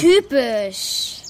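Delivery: loud scornful dismissal. Acted. Daughter fed up with her father, scornful. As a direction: spit out disdain.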